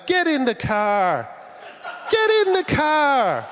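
put-on a comic father luring a girl into the car; mock creepy dad. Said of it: "the father in the car saying".

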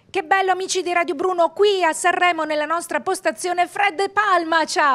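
fast, high and wired; buzzing on too many coffees; too much caffeine. Real, not acted. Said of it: "Ho bevuto troppi caffè".

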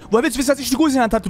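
ordinary delivery

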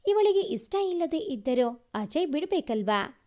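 ordinary delivery, nothing marked

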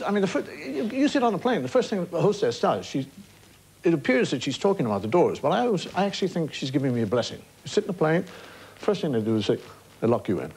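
usual speaking voice

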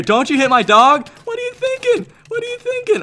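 loud, rising to a high screech; indignant outburst. Real, not acted. Player defending his dog from an attacker, indignant. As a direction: tell off whoever attacked your pet.

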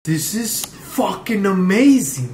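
slow, loud and amazed. Real, not acted. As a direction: savour the surprise. Amazed by the scene.

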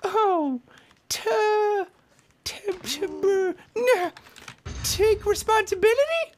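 high squeaky cartoon voice; squeaky character voice. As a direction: play the little character in a pinched high voice. Voicing game character, squeaky.

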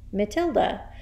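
usual speaking voice

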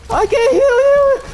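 high squeaky voice. Pretend. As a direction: squeak it out slowly. Squeaky drawn-out plea.

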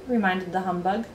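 ordinary delivery

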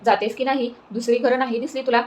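plain reading voice

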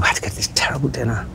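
fast confiding whisper. Real, not acted. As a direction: hush it as if sharing a secret. Confiding about awful dinner.

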